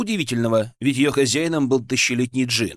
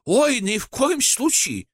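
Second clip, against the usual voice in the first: emphatic, higher and slower reassurance; reassuring genie; 11 semitones higher, slower than usual. Acted. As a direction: reassure warmly, drawn out. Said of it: "заверил меня Джин".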